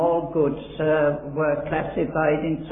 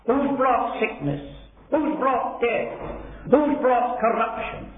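raised, high-pitched righteous indignation; indignant Jesus; 6 semitones higher than usual. Acted. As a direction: voice Jesus grieved and outraged at sin. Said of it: "he was indignant".

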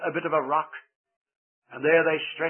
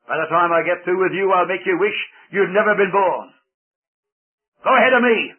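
menacing giant, louder and louder; threatening giant; 2 semitones higher, 6 dB louder than usual. A narrator's reading.

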